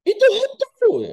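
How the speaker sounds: high, loud scream of ghost. Acted. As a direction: scream it in fright. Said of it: "Mereka teriak semua".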